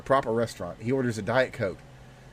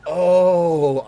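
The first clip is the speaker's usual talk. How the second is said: loud surprised oh; 5 semitones higher, 9 dB louder than usual. Retold or imitated. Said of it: "it's like".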